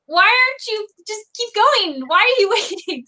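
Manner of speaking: urging him on impatiently; exasperated urging. Retold or imitated. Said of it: "But it was a moment that I'm like".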